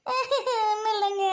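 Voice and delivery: high, slow, drawn-out whimper; meek cover-up. Acted. Wife denying her complaints to her husband, meek whimper. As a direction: stretch it out high and innocently.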